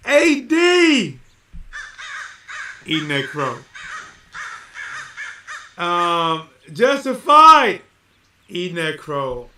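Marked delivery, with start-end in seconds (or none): 0.1-1.2 high, shouted name call; loud call-out. Real, not acted. Streamer calling out a viewer to eat crow, loud shout. 6.7-7.8 slow, high, shouted name; loud call-out. Real, not acted. Streamer calling out a viewer to eat crow, drawn-out shout.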